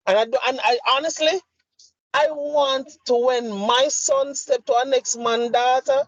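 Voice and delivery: high, insistent, exasperated tirade; impassioned rant. Real, not acted. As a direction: rant with rising heat, pitched well above normal. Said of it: "this rant goes on and on".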